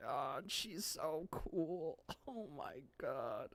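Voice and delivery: very soft, slow, swooning; hushed awe. Real, not acted. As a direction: let it trail into a tiny awed murmur. Overcome by admiration for characters.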